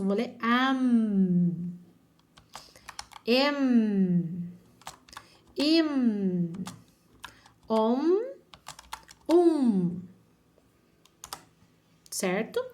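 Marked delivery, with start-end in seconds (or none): 0.4-1.7 AM drawn out into a hummed M; sounded-out syllable. Shown as a demonstration. Teacher reading vowel before M, drawn-out "am". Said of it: "como que eu vou ler isso?". 3.3-10.0 "Em", "Im", "Om", "Um" each ending in a held hum; spaced-out "Em. Im. Om. Um." with long closed-lip M; sounded-out syllables. Shown as a demonstration. Teacher reading vowels before M, drawn-out "Em, Im, Om, Um". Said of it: "como que eu vou ler isso?".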